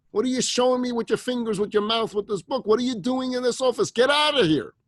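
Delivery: high, loud, indignant scolding; enraged. Acted. Enraged employee ordering the praying woman out, shouting. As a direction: scold furiously, building to a shouted final order. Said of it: "got this woman more enraged".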